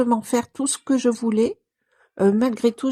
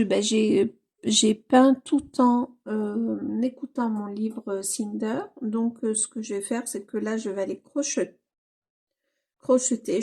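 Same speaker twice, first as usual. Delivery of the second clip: ordinary delivery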